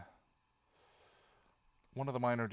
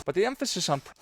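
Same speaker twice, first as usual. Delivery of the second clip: laughing; 5 dB louder, faster than usual. Real, not acted. Breaks down laughing on retake.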